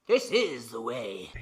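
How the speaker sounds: low, slow solemn creed; solemn catchphrase. Pretend. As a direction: intone it like a sacred oath. Mandalorian sign-off catchphrase.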